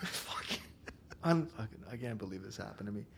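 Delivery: ordinary delivery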